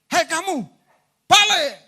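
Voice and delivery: high, loud command to a spirit; preacher calling a child's spirit back to its body. Retold or imitated. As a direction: call the soul back sharply.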